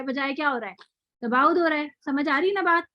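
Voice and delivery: ordinary talk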